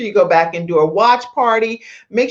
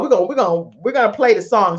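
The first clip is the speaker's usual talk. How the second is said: ordinary delivery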